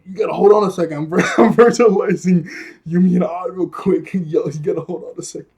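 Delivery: fast, loud, laughing plea to wait; mock frantic rush. Jeremy busy virtualizing as water rises, mock frantic rush. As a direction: rattle it off as a harried imitation.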